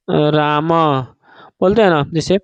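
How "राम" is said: राम held long and low; drawn-out Ram. Shown as a demonstration. Teacher giving a chant example, drawn-out राम.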